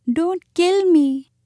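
high, slow plea of a timid muskrat; almost weeping. A narrator's reading. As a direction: plead pitifully, drawn out and high. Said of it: "almost weeping".

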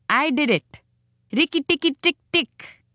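high-pitched mongoose victory chant; triumphant chatter. A narrator's reading. Rikki Tikki claiming he killed Nag, triumphant chatter. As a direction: crow it high, then chatter the syllables.

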